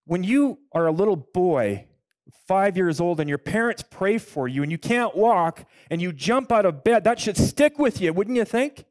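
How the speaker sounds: ordinary talk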